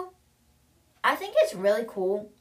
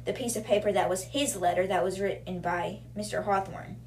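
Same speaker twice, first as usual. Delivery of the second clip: ordinary delivery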